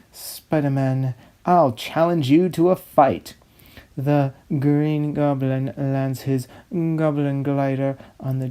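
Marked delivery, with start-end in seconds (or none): 1.4-3.3 louder, bold hero challenge; heroic challenge. Acted. Spider-Man challenging the Green Goblin, loud and bold. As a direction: call him out to fight.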